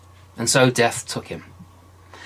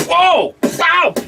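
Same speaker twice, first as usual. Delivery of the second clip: much higher, louder whoa and pow; excited storytelling; 6 dB louder than usual. A narrator's reading. Narrator acting out the hammer strike, loud and high. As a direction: burst out with the blows.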